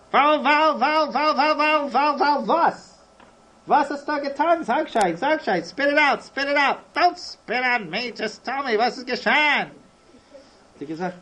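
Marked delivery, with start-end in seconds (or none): 0.1-3.0 high, repeated stutter bursting into a question; rapid-fire wailing splutter; spluttering stammer. Acted. Acted repeated stammer before a question. 3.7-9.7 high, insistent questioning; exasperated, raised-pitch urging; urgent demand. Acted. Acted neighbour demanding the story.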